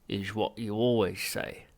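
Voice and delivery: slow, rumbling alien voice; rumbling Vogon. Acted. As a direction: sullen slow Vogon complaint. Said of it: "rumbled the Vogon".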